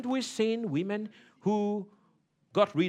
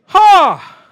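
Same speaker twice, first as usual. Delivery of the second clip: burst of laughter; 11 semitones higher, 20 dB louder than usual. Real, not acted. Laughs at himself.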